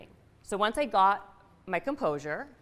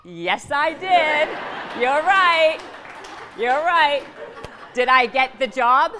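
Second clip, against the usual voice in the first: slow, high, very loud victory shout; triumphant shout; 7 semitones higher, 10 dB louder, slower than usual. Real, not acted. Rallying the audience over the audition, loud triumphant shout. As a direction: whip the crowd up.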